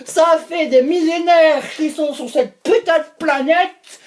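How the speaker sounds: high, stretched heckling; mock exasperated viewer. Viewers objecting to the fruit theories, mock exasperated. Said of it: "vous allez me dire".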